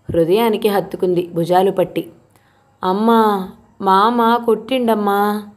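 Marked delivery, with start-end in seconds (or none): 2.8-5.5 put-on slow, pleading little boy's voice; a beaten child whimpering his complaint; hurt child. A narrator's reading. The beaten boy tells Arundhati his uncle hit him, slow childlike plea.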